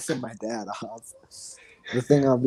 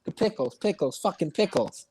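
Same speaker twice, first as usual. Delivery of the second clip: higher, exasperated retold needling; too-loud taunt; 7 semitones higher than usual. Getting payback on the friend who tapped him, taunting. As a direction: throw the annoyance back at him. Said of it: "I said it too loudly".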